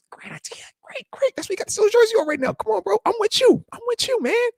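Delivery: whispered then high mock praise. Praising his son for the jersey idea, whispered then high. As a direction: hush then squeak your approval.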